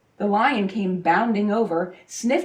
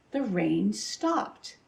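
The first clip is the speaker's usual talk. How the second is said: ordinary delivery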